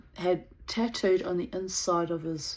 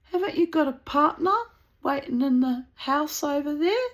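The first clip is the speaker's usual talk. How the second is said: pointed retort; 10 semitones higher, 7 dB louder than usual. Retold or imitated. Pointed retort about his partner.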